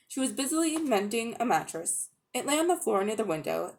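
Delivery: usual speaking voice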